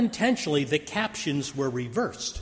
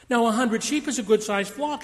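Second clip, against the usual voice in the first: ordinary talk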